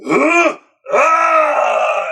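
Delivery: hammed-up creature roars; monster grunts. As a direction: swing and roar like Frankenstein. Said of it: "Frankenstein is like".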